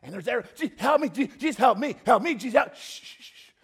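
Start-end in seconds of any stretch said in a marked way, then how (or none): 0.5-2.8 high, loud, repeated cries for help; urgent, tumbling pleas; frantic pleading. Acted. Beggar still crying for help. 2.8-3.5 repeated soft shushes; voiceless hush sounds. Acted. Jesus hushing the noise.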